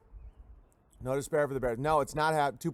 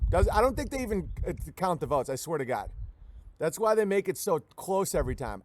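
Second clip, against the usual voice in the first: ordinary talk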